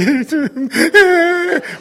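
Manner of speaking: high, loud blubbering wail; imitated weeping. Brothers up at the front overcome, imitated crying. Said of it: "weeping and crying".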